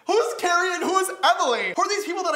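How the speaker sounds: high, loud, incredulous questioning; loud disbelief, pitched right up; baffled exclamation. Real, not acted. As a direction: demand it in amused bewilderment. Baffled by own old dedications.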